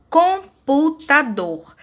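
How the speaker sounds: computador slowly split as com-pu-ta-dor; measured, pause-divided computador; syllable by syllable. Shown as a demonstration. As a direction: break computador into four separate beats. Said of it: "Podemos dividir as palavras em sílabas".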